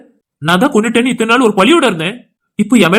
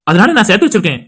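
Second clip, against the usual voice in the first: ordinary talk, nothing marked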